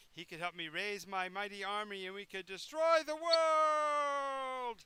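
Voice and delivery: slow, grand evil-overlord proclamation; villainous boast. Acted. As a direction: gloat about world domination.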